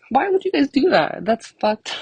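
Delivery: high-pitched, indignant mock wail; retold outrage. Confronting friends about the dangling Band-Aid, retold outrage. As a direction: protest in betrayed disbelief, pitch shooting up. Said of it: "I was so mad".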